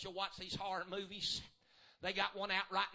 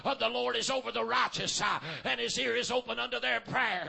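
rhythmic whooping preacher cadence; full-force preaching; 6 dB louder than usual. Acted. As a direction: build it back up, chanting each phrase. Preaching builds again.

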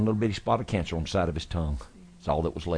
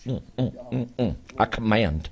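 laying a curse; 4 semitones higher than usual. Real, not acted. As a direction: command the illness out in prayer. Praying over the sick man.

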